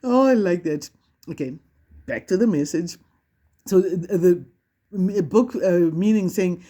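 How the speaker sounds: ordinary talk, nothing marked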